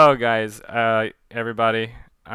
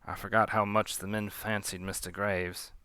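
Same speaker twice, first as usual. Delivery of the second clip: ordinary delivery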